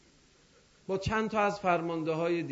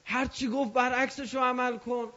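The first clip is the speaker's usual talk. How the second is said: higher pitched, frantic plea of a doomed man; a pleading mock shout, voice pitched up high; desperate cry; 6 semitones higher than usual. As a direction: cry out to stop him listening.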